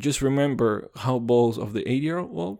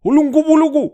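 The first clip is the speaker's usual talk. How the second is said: mock exotic name shout; 13 dB louder, faster than usual. Mock African name, loud.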